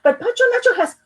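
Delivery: high, fast pleading; a little boy interrupting his mother; eager child. Acted. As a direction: rush to get the words out. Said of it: "Juan tried again".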